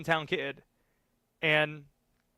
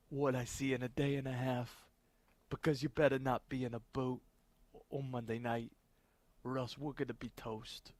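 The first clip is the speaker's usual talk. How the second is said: put-on softer New Jersey accent; Dan Hurley Jersey accent; 5 dB quieter than usual. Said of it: "Just how atrocious is this accent?".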